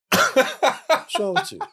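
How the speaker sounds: high, slow laughing scold. Real, not acted. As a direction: laugh through the scolding question. Mock scolding grown man, laughing.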